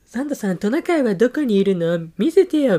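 put-on high, pleading kid voice; child voice. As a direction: ask like an eager little kid. Child asking Santa in case study.

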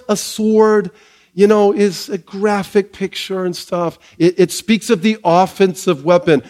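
rising, emphatic sermon delivery; full-force pulpit preaching. Acted. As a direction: preach it out to the church with conviction. Preaching to the congregation.

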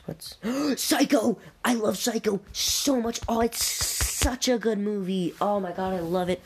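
light up over a favorite movie; thrilled gush. Real, not acted. Gushing over favorite film.

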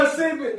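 Mississippi squeezed down like two syllables, mesepe; southern Mississippi. Shown as a demonstration. Showing friends how locals say Mississippi, slurred. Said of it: "you got two goddamn syllables".

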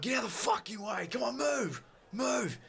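high, strained shout; urgent, high-pitched holler; yelling coachman. Acted. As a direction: yell at a crowd to clear the road. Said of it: "the coachman on there is actually yelling".

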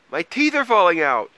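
high, loud alarm; panicked cry. A narrator's reading. Troll tells Mole about his teeth, panicking. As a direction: wail it out in hammed-up panic.

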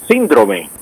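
síndrome stressed on SÍN; stress on first syllable. Shown as a demonstration. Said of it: "con tilde en la I".

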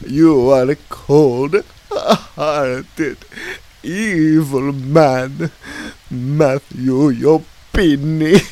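very slow, loud, high denunciation; loud accusation. Acted. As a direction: drag out each insult theatrically. Clown insults host.

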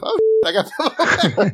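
laughing imitation. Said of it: "Just a random phrase of".

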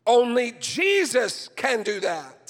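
loud, high, fervent preaching at full force; frustrated preaching. Real, not acted. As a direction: pour your frustration into the sermon, loud and high. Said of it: "I sound frustrated in my voice".

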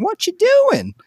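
high-pitched mock passerby; nosy passerby. Passerby interrupting the film shoot, high nosy mock voice. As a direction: squeak it like a busybody neighbor.

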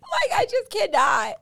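high, loud squeal of laughter; cracking up while talking; helpless laughter. Real, not acted. Cracking up over tipsy slip.

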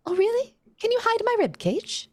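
high hopeful creature. Acted. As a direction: perk up, squeaky with sudden hope. Abraham asks for help.

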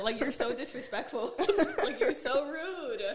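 half laughing, half hurt at God; laughing scold. Retold or imitated.

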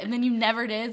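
usual speaking voice

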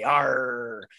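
a quick pirate "arr" tacked on; pirate growl. Acted. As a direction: throw in a light pirate "arr". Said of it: "with me pirate accent".

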